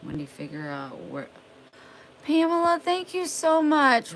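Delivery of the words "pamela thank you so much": excited thanks. Real, not acted. Thanking Pamela live on stream, high excited gush. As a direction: squeal out grateful thanks.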